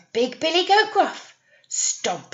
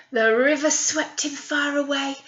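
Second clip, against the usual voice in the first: usual speaking voice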